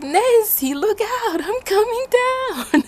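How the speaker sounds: high, slow, loud called warning through laughter; laughing mock shout. Teacher on the hilltop, hammed-up shout with a laugh. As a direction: call out slowly, cracking up.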